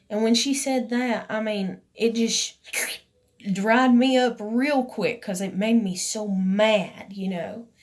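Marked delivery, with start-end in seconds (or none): none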